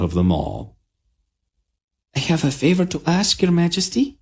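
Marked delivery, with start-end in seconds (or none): 2.1-4.2 put-on high, quick girlish voice; young princess. A narrator's reading. Princess Tenedra to the queen, high and girlish. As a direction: ask a favour sweetly.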